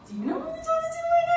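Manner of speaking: high-pitched, rapid sassy comeback; sassy outrage. Retold or imitated. Headstrong kindergartner to a boy who excluded her, mock outrage. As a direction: fire it off high and fast, mock-indignant.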